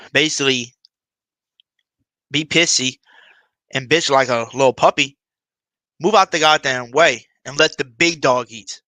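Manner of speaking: loud, halting paraphrase with heavy pauses; punched-up retelling of a pep talk; emphatic retelling. Retold or imitated. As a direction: drive the message home loudly, phrase by phrase. Emphatically relaying the player's speech.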